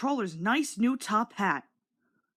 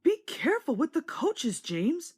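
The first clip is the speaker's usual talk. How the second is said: put-on higher, gentle old engine warning; Edward warning; 7 semitones higher than usual. A narrator's reading. Edward warning James about the coaches, higher and gentle. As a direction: caution the young engine like a kindly elder. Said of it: "said Edward".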